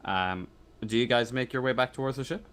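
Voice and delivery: ordinary delivery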